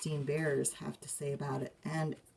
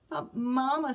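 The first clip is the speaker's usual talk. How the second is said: pleading cub; 8 semitones higher, 4 dB louder than usual. Acted. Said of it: "said brother".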